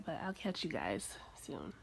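soft sleepy whisper; drowsy whispered goodbye. Real, not acted. Sleepy sign-off, going back to bed.

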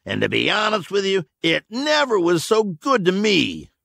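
a hint of a cocky outlaw; defiant drawling thug. A narrator's reading.

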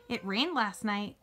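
plain reading voice

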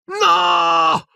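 very high, long cry of no; horrified scream. A narrator's reading. Boy seeing his professor collapse, horrified scream. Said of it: "urlò Harry".

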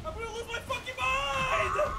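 screaming in frustration; raging gamer. Real, not acted. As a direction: lose it completely, yell. Raging at the game boss.

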